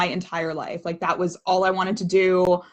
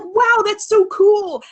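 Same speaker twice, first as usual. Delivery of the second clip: slow, much higher, louder gushing wow; mock impressed; 13 semitones higher, 6 dB louder, much slower than usual. Mimicking people gushing over her acting career, slow and high. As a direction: ham up being dazzled. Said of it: "you know, like".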